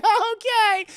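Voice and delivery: very high, loud reluctant okay; dad to Esther, high strained agreement. Retold or imitated. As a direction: squeak out a forced okay.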